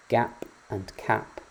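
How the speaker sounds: gap and cap said slowly, set side by side; slow contrast. Shown as a demonstration. Teacher contrasting gap and cap slowly. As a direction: lean on the first sounds, slowly. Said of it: "the difference between the words".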